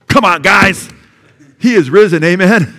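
loud, high preacher's exclamation; rousing shout. Real, not acted. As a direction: shout it out to rally the congregation. Preacher rousing congregation at climax.

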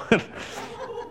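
soft unvoiced laugh; breathy laugh. Real, not acted. Preacher laughing at his own story, breathy laugh. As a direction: let a quiet laugh escape.

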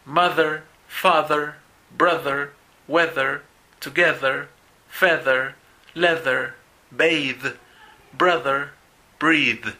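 "Mother" and "father" with a buzzing voiced th; voiced th words. Shown as a demonstration. Teacher reads the voiced column so students hear the contrast, clear. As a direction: voice the th in "breathe" and "brother" with vibration. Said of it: "a partir de mother".

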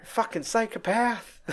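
slow, high mock muttered disbelief; imitating the shaken bowler; shocked bowler. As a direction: react like a rattled bowler. Bowler's reaction to Walter.

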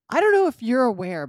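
loud indignant quote; mock loud Spencer. Spencer lecturing the lab tech, loud and pointed. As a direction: blurt it out loudly.

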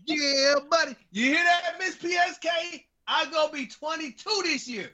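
high-pitched playful bragging; gleeful gloating. Real, not acted. Host brags to viewer about being guessed younger, high gleeful crowing.

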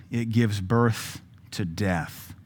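slow, falling "it gives birth to death"; scripture reading. Shown as a demonstration. Preacher reading scripture to the congregation, slow and grave. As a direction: let death land softly at the end. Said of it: "let's keep reading".

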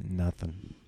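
low, soft one-word brush-off; imitated curt driver. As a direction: answer under your breath, uninterested. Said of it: "He just goes".

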